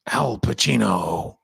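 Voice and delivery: drawn-out American accent demo. Shown as a demonstration. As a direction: put on a slow American accent. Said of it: "That's my American accent".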